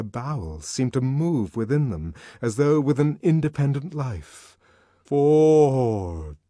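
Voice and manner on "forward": long drawn-out, falling cry; ecstatic voice. A narrator's reading. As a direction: draw the word out loud, letting it fall. Chant of ecstatic ceremony voice.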